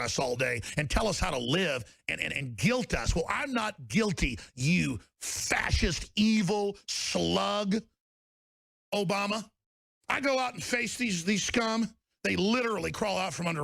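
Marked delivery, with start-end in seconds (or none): 4.5-9.4 each word dragged out slowly; slow insult. Real, not acted. Host slowly insulting Obama.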